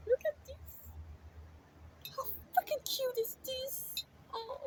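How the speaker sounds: high cutesy voice. Real, not acted. Cooing over her cute incense holder in a high voice. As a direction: gush over it in a tiny high voice.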